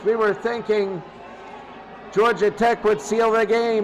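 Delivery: usual speaking voice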